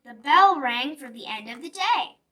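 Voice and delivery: ordinary delivery, nothing marked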